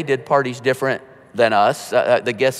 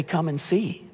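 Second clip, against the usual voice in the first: soft invitation; 3 semitones higher, 7 dB quieter than usual. Shown as a demonstration. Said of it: "the simple invitation".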